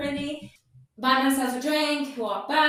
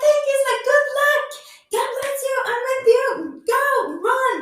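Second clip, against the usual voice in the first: very high, loud acted send-off; excited shouted farewell; 12 semitones higher, 7 dB louder than usual. Passenger and driver part in a rush, excited shouting. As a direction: call out high over a hurried goodbye. Hurried farewell at station, retold.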